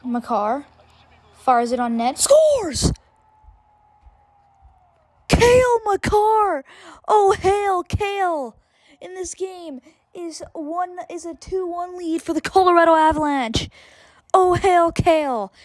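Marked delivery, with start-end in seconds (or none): 2.2-8.6 ecstatic high shouted goal call. Real, not acted. Goal call, Makar scores. 9.0-15.5 excited call. Real, not acted. Goal call continues.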